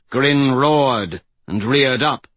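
usual speaking voice

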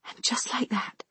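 hushed whisper. A narrator's reading. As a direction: breathe it out in shock. Narrator's hushed horror.

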